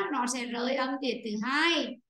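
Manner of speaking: ordinary talk, nothing marked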